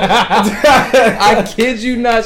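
loud high laughing, slowed; barely gets words out through laughter; real laughter at the name reveal; laughing hard. Real, not acted. Cracking up at his own story.

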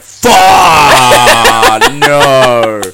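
loud drawn-out mock voice crack; voice breaking. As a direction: cry out with a breaking voice, then laugh. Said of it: "my voice broke".

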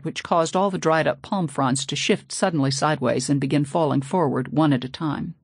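ordinary delivery, nothing marked

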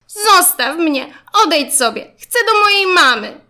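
high, loud acted tantrum; upset child shouting. A narrator's reading. Zygzak rejecting a classmate, wanting mama, upset shouting. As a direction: wail and push them away.

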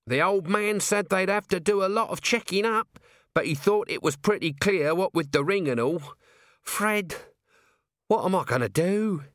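high-pitched, plaintive character voice; helpless appeal to a friend; fretful, put-upon little man; distressed Nobby. Acted.

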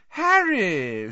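high, loud, delighted greeting; beaming greeting. A narrator's reading. Reporter spots Harry in the pub, beaming. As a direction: sing out the name with delight.

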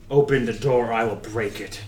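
threatening regal voice. Acted. Markov threatening at the door.

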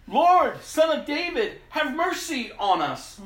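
high, loud desperate cry. Acted. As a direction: cry out even harder. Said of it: "they only shouted louder".

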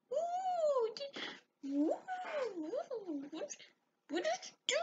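very high, soft cooing voice; imitated video character. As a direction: mimic a tiny, squeaky character quietly.

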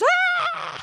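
high squealing mock scream of a kid; fake howl. Boy pretending to be spanked, high fake cry. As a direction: yelp as if the belt really landed. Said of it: "And the kid goes".

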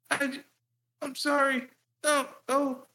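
put-on high pleading little-kid voice; scared kid. Little him pleading with his angry dad, high and whiny. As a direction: squeak out a mock apology. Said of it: "I'm like".

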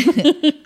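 high, loud villainous cackle; hammed-up spooky cackle for effect; mock evil laugh. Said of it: "I do that for you".

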